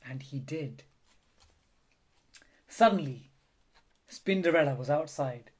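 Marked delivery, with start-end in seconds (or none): none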